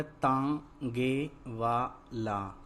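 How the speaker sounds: तांगे वाला slow, in separate syllables; तांगे वाला with every syllable held apart. Shown as a demonstration. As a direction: sound out तांगे वाला piece by piece. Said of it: "तो क्या शब्द बना".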